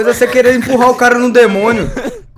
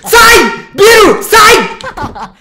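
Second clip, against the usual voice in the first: panicked shouting, high and loud; scared horror game streamer; 8 semitones higher, 5 dB louder, much slower than usual. Real, not acted. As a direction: yell in terror to get away. Monster chasing in horror game.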